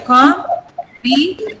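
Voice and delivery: slow Combination split into four beats; com, bi, nay, shun with gaps; syllable by syllable. Shown as a demonstration. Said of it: "There are four syllables here".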